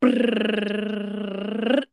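rolled lips drum roll sound. Shown as a demonstration. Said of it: "drum roll".

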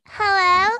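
put-on high, loud girly hello; cute phone voice. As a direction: mimic a girl picking up the phone. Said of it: "when girls get on the phone, they're like".

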